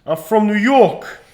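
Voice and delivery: slow, high, loud New York demo; New York accent. Shown as a demonstration. Coach repeating the line for a pupil, New York accent. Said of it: "try saying".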